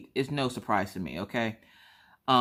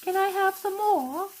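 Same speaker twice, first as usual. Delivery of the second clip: much higher, slow pleading orphan voice; Tiny Tim; 5 dB louder, much slower than usual. Retold or imitated. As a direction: beg like a hungry little orphan. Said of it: "Tiny Tim?".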